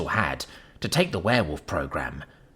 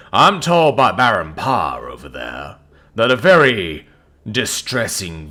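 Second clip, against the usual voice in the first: booming; 8 dB louder than usual. Acted. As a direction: command the room, booming. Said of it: "he booms".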